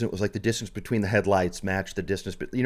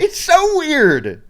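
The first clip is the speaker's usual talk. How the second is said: much higher, louder, stretched-out amazement; exclaiming; 7 dB louder, much slower than usual. Real, not acted. Podcaster echoes co-host about the odd phrase, loudly incredulous. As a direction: draw it out in a high, loud cry of disbelief.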